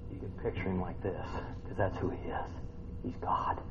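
soft reverent near-whisper; hushed. Real, not acted. Preacher telling listeners who Jesus is, hushed. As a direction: drop to a whisper of awe.

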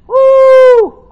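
a high, loud joyful whoop; loud praise holler. Shown as a demonstration.

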